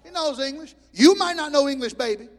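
high, loud insistent retort; preacher telling interpreter off, loud; loud rebuke. Retold or imitated. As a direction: shout the point home.

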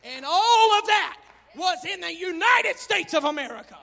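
loud, rising preacher's cry; fiery pulpit shout; preaching at full force. Acted. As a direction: preach it out to the congregation. Preacher proclaiming his motto.

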